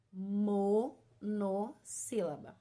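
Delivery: Monossílaba slowly, mo... no... sílaba; slow teaching repeat, mo, no, sílaba; term sounded out. Shown as a demonstration. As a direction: break Monossílaba apart with pauses. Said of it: "ela vai se chamar monossílaba".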